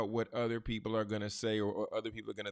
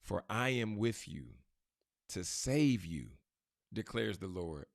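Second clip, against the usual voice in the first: slow and lower after a long pause, letting it land; pause, then drop it slow; pause for emphasis; 4 semitones lower, slower than usual. Shown as a demonstration. Preacher showing a pause for emphasis, slow and lower. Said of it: "that's a pause for emphasis".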